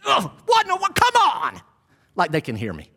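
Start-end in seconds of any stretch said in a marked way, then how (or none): 0.1-1.6 high-pitched, exasperated yell at the game; worked-up armchair fan shouting; fired-up sports fan. Acted. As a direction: holler at the coach through the TV. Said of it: "I get fired up, right?".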